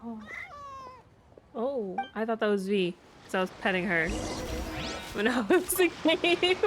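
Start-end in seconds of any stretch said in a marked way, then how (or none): none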